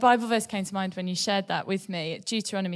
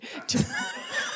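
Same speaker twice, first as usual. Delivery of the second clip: a high, breathless laugh; burst of laughter. Real, not acted. Host laughing at Megan's maniac joke, a high breathless laugh.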